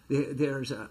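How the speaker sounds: usual speaking voice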